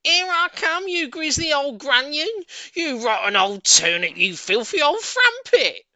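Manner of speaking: screaming high and loud from above; top of her voice. Acted. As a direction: bellow the insults at full blast. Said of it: "called out at the top of her voice".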